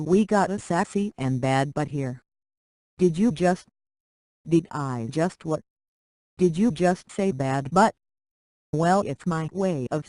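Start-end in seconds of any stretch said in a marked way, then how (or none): none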